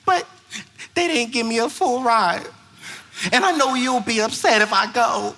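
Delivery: high, hesitant imitated girl; teen girl. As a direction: play a girl timidly explaining. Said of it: "And she was like".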